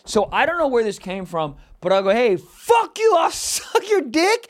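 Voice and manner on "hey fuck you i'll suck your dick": high, drawn-out angry comeback; reenacted high-pitched outburst; road-rage yell. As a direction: yell a comeback at another driver, slowly. Retelling his road-rage comeback.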